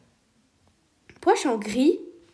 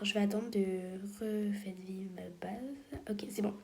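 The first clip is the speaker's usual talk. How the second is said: whisper; 3 semitones lower, 10 dB quieter than usual. Real, not acted. Whispers while waiting in game.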